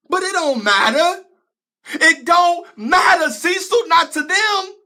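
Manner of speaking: loud, high-pitched anguished protest; frustrated outburst. Acted. Pleading with Cecil that effort counts for nothing, frustrated outburst. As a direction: cry out against the injustice, voice climbing.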